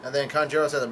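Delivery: usual speaking voice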